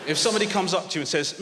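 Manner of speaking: usual speaking voice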